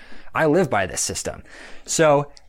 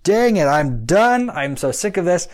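higher, louder, slow exasperated outburst; fed up; 6 semitones higher, 4 dB louder, slower than usual. Retold or imitated. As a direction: throw up your hands in disgust. Retelling giving up again.

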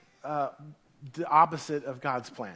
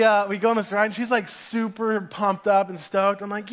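ordinary talk

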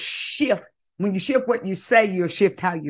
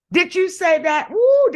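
normal talking voice

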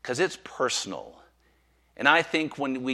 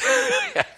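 short high burst of laughter; sudden high laugh; 6 dB louder than usual. Real, not acted. Preacher laughing about lying in a real field, high burst. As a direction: laugh at the memory.

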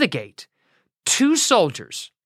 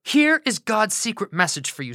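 ordinary delivery, nothing marked

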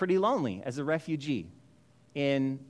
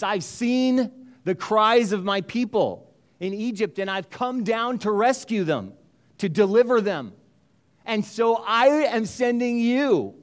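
voice of God; 5 semitones higher, 5 dB louder than usual. Acted. God's commands voiced in sermon.

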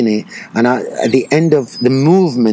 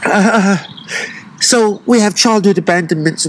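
raised, tearful voice, gathering oneself; still emotional; 6 semitones higher than usual. Real, not acted. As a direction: push on through real tears. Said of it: "Why am I getting so emotional".

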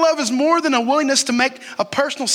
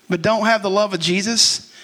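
ordinary talk, nothing marked

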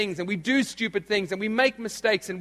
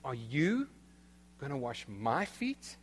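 low, soft question, YOU and MY rising; stress on YOU and MY; 5 semitones lower, 11 dB quieter than usual. Shown as a demonstration. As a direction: lean on YOU, then MY, quietly questioning. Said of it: "that literally is the emphasis".

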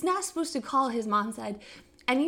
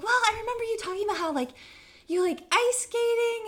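lightly voiced mom, pitched up; eager matchmaking mother; 10 semitones higher, faster than usual. Imitating the lady setting up her son, eager. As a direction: imitate a pushy mom in a higher voice.

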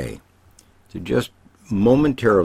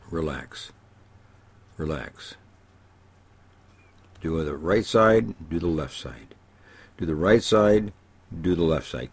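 guided relaxation; 4 dB quieter than usual. Acted. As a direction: ease them through each body part. Leads a relaxation exercise.

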